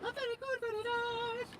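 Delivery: very high squeaky praise voice; falsetto very good. Pretend. Fisherman praising the fish in a silly high voice. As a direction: squeak it out like a cartoon.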